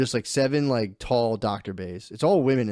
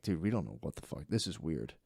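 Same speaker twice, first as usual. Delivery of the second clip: a low, hushed, baffled doctor voice; mock puzzled doctor; 7 semitones lower, 12 dB quieter than usual. Husband voicing confused doctors, low and hushed. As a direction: mutter it like a clueless doctor.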